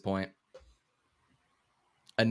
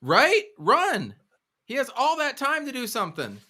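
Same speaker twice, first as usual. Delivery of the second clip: escalating comedy rant; 5 semitones higher than usual. Real, not acted. Fan rant continues.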